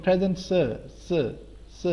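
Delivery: س as "suh", no r; clipped-off r, just "suh" for س; silent final r. Shown as a demonstration. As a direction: model present س with the r dropped from sir. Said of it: "سر نہیں ہوتا".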